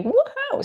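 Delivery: very high celebratory whoop; excited party "woohoo"; high-pitched woohoo. Shown as a demonstration. Demonstrates going wild with praise.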